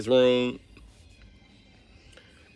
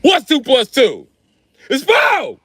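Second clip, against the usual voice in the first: mock teacher yelling; 12 semitones higher, 6 dB louder, slower than usual. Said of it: "male teacher coming".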